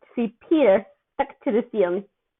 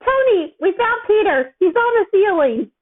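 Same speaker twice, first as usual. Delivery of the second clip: loud, much higher shout across the house; shout from another room; 10 semitones higher, 5 dB louder than usual. A narrator's reading. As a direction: yell the news to Tony from far off. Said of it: "a shout came from another room".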